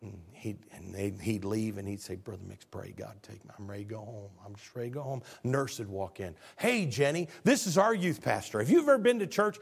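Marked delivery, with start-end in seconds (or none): 2.2-5.2 weary plea. Retold or imitated. Dying man begging in pain. 6.6-9.6 eager invite. Retold or imitated. Sick man inviting the nurse.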